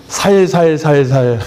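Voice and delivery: "살살살살" with plain s where 쌀 has tense ss; Gyeongsang pronunciation. Shown as a demonstration. Preacher teasing Gyeongsang people about saying rice, dialect pronunciation. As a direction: slowly show "살살살살", the tense ss softened to s. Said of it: "쌀이 잘 안 된다면서요?".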